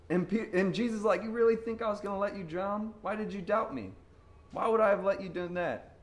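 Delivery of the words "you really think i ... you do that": higher-pitched, disbelieving questions; incredulous reassurance. Acted. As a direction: ask as if letting them fall were unthinkable. Jesus gently challenging sinking Peter.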